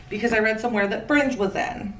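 ordinary talk

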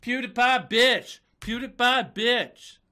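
cheeky rallying cry; hype shout. Acted. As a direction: bark it like a hype chant. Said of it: "not supposed to say that word".

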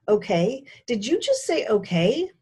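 loud, high disbelief; shocked. A narrator's reading. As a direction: react in stunned disbelief. Jaw slackened, surprise.

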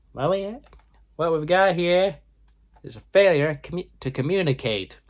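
high, nasal movie-quote voice. Retold or imitated. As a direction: squeeze it up high through the nose. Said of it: "very high, nasally voice".

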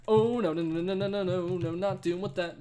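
high panicked no's; panicked shrieking. Real, not acted. Chased in game.

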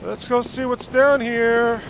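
usual speaking voice

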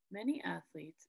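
ordinary talk

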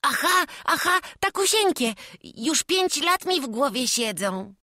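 put-on high, loud, delighted child; a boy bursting with longing; eager little boy. A narrator's reading. Chłopiec z przejęciem potwierdza królewiczowi, wysoko i głośno.

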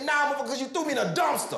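high, fast laughing comeback; mock angry retort. Teen answering Champ after the bottle, quick and high. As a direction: fire it back fast and high.

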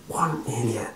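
hushed, whispery mutter. A narrator's reading. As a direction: mutter it under your breath. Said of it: "I muttered".

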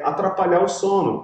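ordinary talk

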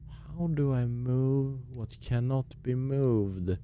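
slow pondering. Pretend. Gamer muses over a puzzle, slow pondering. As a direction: stretch the words out as if pondering a riddle.

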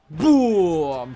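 loud mock explosion boom; a hammed-up loud boom; loud boom effect. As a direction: shout boom on the impact. Sound effect for a slam.

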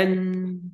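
"إن" held high on one note; isolated syllable. Shown as a demonstration. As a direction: sound out "إن" as a separate beat. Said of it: "أدي مقطع لوحده".

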